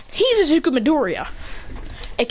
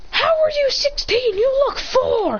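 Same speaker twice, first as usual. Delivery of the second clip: high-pitched squawk of disbelief; incredulous outburst; 13 semitones higher, slower than usual. Acted. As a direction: shoot it up high, stretching the end. Disbelieving reply to the kid.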